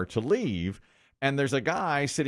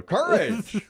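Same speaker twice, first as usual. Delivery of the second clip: higher, louder shout of the answer; louder call-out; 11 semitones higher, 3 dB louder than usual. Retold or imitated. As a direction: call it out louder, over his head. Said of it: "so I go louder".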